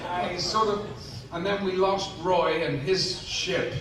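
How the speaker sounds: ordinary delivery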